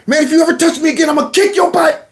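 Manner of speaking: high, fast mock yell; acted angry threat. Boy jumps up to threaten the bully, mock angry yell.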